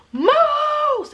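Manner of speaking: high yelp of alarm; retold panicked cry of moose; alarmed shout. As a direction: yell the warning high and sudden. Friend spots moose in dark.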